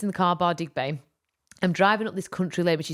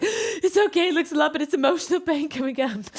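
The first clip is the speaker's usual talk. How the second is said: teary reassurance; 9 semitones higher than usual. Retold or imitated. Mum reassuring kids mid-cry.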